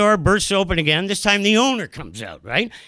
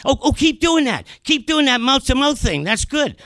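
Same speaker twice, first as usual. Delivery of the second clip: lightly voiced, higher-pitched paramedic; paramedic's encouragement; 7 semitones higher than usual. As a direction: egg the rescuer on in a raised voice. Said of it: "the first guy says to me".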